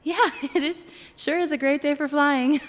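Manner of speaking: high, loud nervous gush; overeager. Acted. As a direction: gush too much at the star. Nervous overeager greeting to actor.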